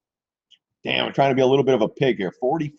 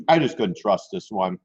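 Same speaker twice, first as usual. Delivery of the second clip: ordinary talk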